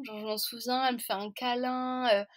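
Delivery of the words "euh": ordinary delivery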